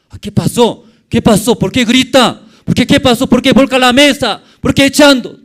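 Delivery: high, loud, rapid-fire shocked questions; alarmed outcry. Acted. Preacher voicing the crowd watching Jesus overturn tables, alarmed outcry. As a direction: cry out in bewildered protest.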